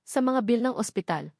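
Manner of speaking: ordinary delivery, nothing marked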